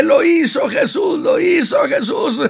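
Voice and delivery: a very high, loud triumphant cry; shouting in the street. Acted. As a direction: call it out down every street. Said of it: "se va gritando calle por calle". (el leproso grita calle por calle, triunfante)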